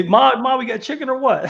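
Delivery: high, loud whiny call for mom; mock yell to mom. Imitating the drug dealer yelling to his mom, high and loud. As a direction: holler it up the stairs like a lazy son.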